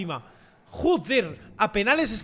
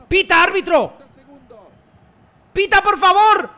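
high, loud begging yell; desperate pleading shout; 8 semitones higher, 6 dB louder than usual. Real, not acted. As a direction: beg for the final whistle. Begging referee to end match.

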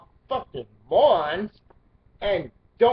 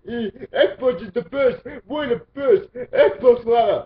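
slow, whiny mock fanboy voice, higher and louder; mocking Xbox fanboy; 4 semitones higher, 6 dB louder, slower than usual. Mocking Xbox fans with a whiny fake-crying fanboy voice. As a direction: ham up a crying fanboy's nasal whine. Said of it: "believe that".

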